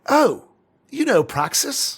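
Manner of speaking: high, loud delighted exclamation; delighted surprise. Acted. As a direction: gasp with delight at the name. Character thrilled at name.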